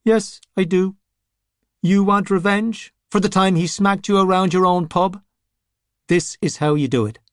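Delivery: a rotting boss puts on airs; pompous zombie. Scapegrace offers information.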